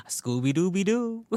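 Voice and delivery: high cartoon dog catchphrase; Scooby-Doo. Fan doing Scooby's famous catchphrase for laughs, high cartoon impression. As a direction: put on a playful, high cartoon voice. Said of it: "And Scooby's just like".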